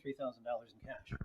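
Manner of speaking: mumbled away from the mic; off-mic quiet. Real, not acted. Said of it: "Say that into the microphone".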